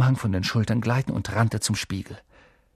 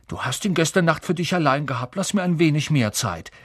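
squabbling complaint; 6 semitones higher than usual. Acted. Setzte ihrer Streiterei ein Ende.

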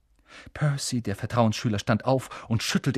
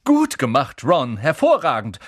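loud, self-important praise; pompous; 4 semitones higher, 8 dB louder than usual. A narrator's reading. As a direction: praise like a proud prefect. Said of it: "sagte Percy Weasley wichtigtuerisch". (wichtigtuerisch und laut)

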